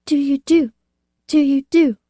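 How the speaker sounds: put-on high, thick, feeble voice of a sick child; strained, weak childlike voice. A narrator's reading. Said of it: "I heard the thick, weak voice".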